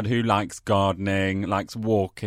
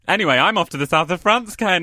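ordinary talk, nothing marked

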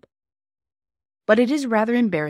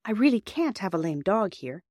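ordinary delivery, nothing marked

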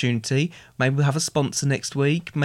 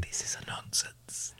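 slow whispered catchphrase; whispering; 13 dB quieter, slower than usual. Pretend. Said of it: "Why are you whispering it?".

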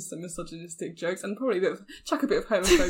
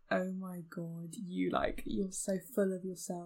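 soft mock sneer; 3 semitones lower, 9 dB quieter than usual. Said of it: "they're like".

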